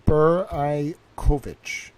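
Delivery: "Beraikovich" slowly, bur... eye... KOH-vich; sounding out. Shown as a demonstration. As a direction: draw "Beraikovich" out syllable by syllable, slowly. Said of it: "I wonder if it's, like".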